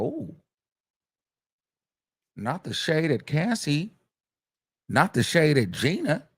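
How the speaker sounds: hushed, amused scandalized reaction; mock gasp. Real, not acted. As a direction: savor the shade with relish. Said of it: "reacting to shade".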